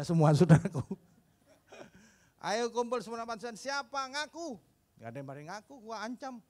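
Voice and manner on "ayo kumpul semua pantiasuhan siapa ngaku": stern pastor. Retold or imitated. Pastor confronting the orphans over the durian, higher and stern. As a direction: summon the kids and demand a confession. Angry pastor summons children.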